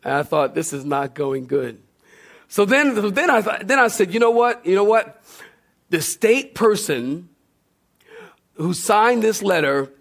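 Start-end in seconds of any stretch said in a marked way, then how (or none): none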